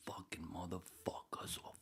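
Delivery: раздражённый беззвучный шёпот; ругайся шёпотом; annoyed whisper. Real, not acted. Muttering annoyed off mic.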